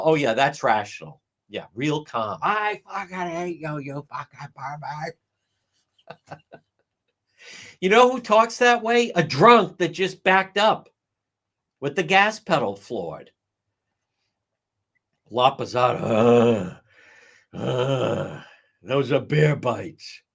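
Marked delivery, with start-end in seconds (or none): 2.4-5.2 slurred imitation fading out; mild mock yell, trailing off; mock drunken rant. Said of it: "she's screaming in the voicemail". 17.5-20.2 halting slow put-on voice; hesitant mimicry; mock witness. Mocking a witness.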